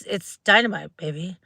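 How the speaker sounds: ordinary talk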